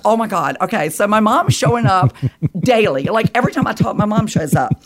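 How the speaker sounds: breathless happy chatter to her husband; excited retelling. Retold or imitated. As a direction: tell him all in a rush of excitement.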